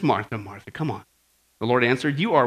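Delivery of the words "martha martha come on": low, soft, tender chiding; gentle, loving rebuke. Acted. Jesus answers Martha because he loves her, low gentle reproof. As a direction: chide her lovingly, very softly. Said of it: "And he loves her. He says".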